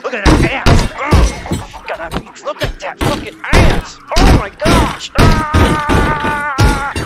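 loud, high, thrilled shouting; excited yelling. Real, not acted. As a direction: yell it out in excitement.

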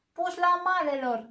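high, loud roaring bellow; bellowing bear. Acted. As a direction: roar as the curtain is ripped aside. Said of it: "zbieră ursul".

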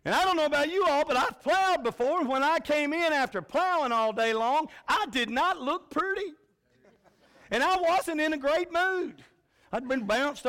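high, loud, pounding sermon voice, slowing at the end; full-force preaching. Acted. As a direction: drive it home to the congregation. Preacher rising at plowing illustration.

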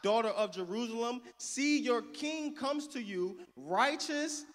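slow, ringing scripture read; rising preaching cadence. Acted.

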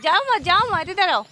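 insistent, high-pitched calling. Real, not acted. Calling wandering child back.

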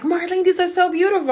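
high, gushing little-sister quote; retold freaking out. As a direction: imitate a sister freaking out with excitement. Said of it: "My little sister was freaking out".

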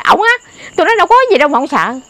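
high, quick teasing jab; teasing retold reassurance; mock teasing. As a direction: tease him for being scared, quick and high. Teasing him for fearing ghosts.

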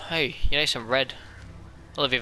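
ordinary talk

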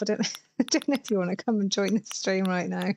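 ordinary delivery

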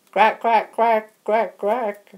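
high, loud squawking crack; creature squawk. Pretend. Toy creature squawking back at the host, high and loud. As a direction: squawk it back like a cheeky bird.

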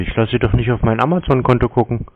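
ordinary talk

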